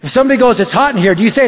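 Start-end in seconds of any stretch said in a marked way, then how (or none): none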